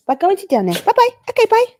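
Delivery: high, loud sing-song shooing; high dog voice. Real, not acted. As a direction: send the dog off in a squeaky voice.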